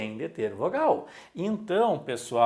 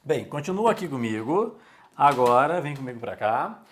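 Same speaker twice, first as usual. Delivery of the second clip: normal talking voice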